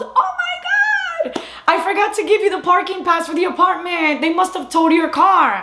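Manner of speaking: high-pitched girl's horrified realization, imitated; mock-panicked, squealy voice of a girl on the phone; shocked squeal. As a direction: draw out a squealed "oh my God", then keep the confession high. Retold girl's shocked reaction on phone.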